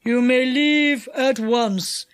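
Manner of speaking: slow, deliberate villain; enchanter's voice. A narrator's reading. Enchanter lets the captives go, slowly and deliberately. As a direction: concede slowly in character.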